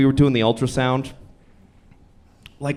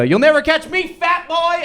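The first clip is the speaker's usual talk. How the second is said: put-on much higher, teasing child's shout; taunting little daughter; 14 semitones higher than usual. Daughter taunting dad as she runs off, high child voice. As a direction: squeal it like a kid running off. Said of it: "my daughter goes".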